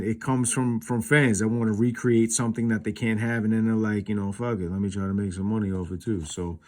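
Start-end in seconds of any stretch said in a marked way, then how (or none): none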